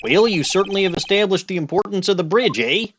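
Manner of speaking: light mock Canadian accent, impatient; Canadian judge. Judge hurries the defense along, mock Canadian accent.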